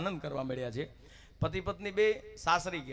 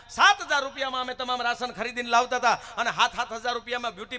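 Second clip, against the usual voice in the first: higher, louder indignant scolding; scolding mother-in-law; 6 semitones higher, 9 dB louder than usual. Retold or imitated. Mother-in-law scolds her daughter-in-law over money, indignant. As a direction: scold the bride in disbelief.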